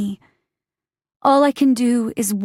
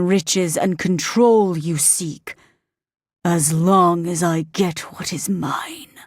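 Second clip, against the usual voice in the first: demon voice; 4 semitones lower than usual. Acted. Demon bargaining with Green, low and menacing. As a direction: speak as an ancient demon granting a bargain.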